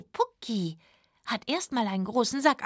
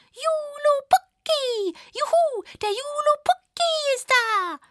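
put-on much higher, loud, drawn-out children's cheer; cheering elf children; 5 dB louder, slower than usual. Elf children cheering the goat man's arrival, high and loud. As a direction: cheer like excited little elves, high and slow. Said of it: "Die haben gerufen".

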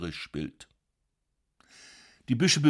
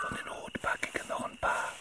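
unvoiced whisper; 9 dB quieter than usual. Brother whispers while stealing apples at night. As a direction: barely breathe it.

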